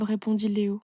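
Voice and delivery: usual speaking voice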